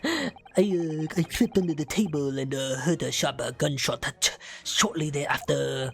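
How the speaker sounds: lightly voiced old man; creaky, hesitant old witness. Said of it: "Just do the old man voice you were doing before".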